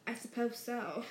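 stiffly. Acted. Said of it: "said Missus Dursley stiffly".

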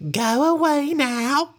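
high, slow falsetto command; lady ordering. Acted. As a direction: shoo the bear away like a prim lady. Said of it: "she ordered".

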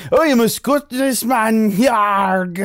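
mock Braveheart Scottish brogue, high and loud; Mel Gibson Scottish accent. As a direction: overblown movie Scottish accent with a growled yarg. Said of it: "Mel Gibson doing a Scottish accent".

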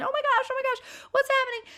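very high-pitched mock panicked squeal; quick, squealing imitation of girls in a panic; mock screaming. Girls imitated standing in the mud spray, mock screaming. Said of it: "just, like, screaming and".

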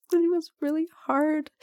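high, strained voice near tears; choked up. Real, not acted. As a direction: fight back tears as you say it. Recalling depression, long pauses around.

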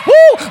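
loud high-pitched whoop; excited woo. Real, not acted. Preacher overcome by his message, excited high whoop.

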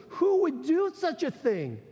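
the king roars his outrage; furious king. A narrator's reading. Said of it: "the king is furious".

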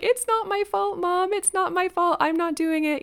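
little girl's high pretend denial; kid's mock protest. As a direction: play a giggly kid protesting innocence. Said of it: "I'd shout at her ... in the funny way that kids do".

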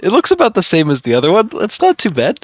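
mock reassurance. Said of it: "I kept telling him".

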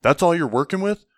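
loud mock NCO taunt; mocking sergeant. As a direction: sneer it like a sergeant ribbing a recruit. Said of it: "and he's like".

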